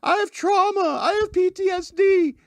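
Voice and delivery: very high mock-distressed squeal; hammed-up women's wail. Host mocking women who claim trauma during arrest, high wail. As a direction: put on a shrill mocking wail, far above your own voice. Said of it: "women doing this a lot".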